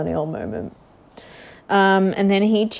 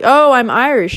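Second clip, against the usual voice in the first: higher, louder mock boast of Irish roots; mocking a fake Irish claim; 6 semitones higher, 5 dB louder than usual. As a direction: sneer at someone bragging about Irish ancestry. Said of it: "it's like when people are like".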